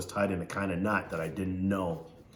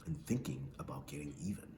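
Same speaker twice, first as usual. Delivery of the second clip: whisper; 7 semitones lower, 15 dB quieter than usual. A narrator's reading. Secret revenge thought.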